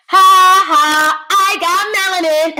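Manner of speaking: gleeful boast, laughing at the haters; triumphant. Real, not acted. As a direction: crow it like a victory lap. Triumphant boast about melanin.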